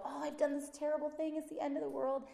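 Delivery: high, wailing voice; hammed-up despair, pitched high; mock despairing child. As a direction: voice yourself as a frantic child. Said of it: "he sees me go".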